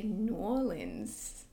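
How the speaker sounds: "New Orleans" slurred into "N'awlins", slowly; local-style New Orleans. Shown as a demonstration. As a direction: relish "New Orleans" as a drawn-out "Nawlins". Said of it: "I like to say".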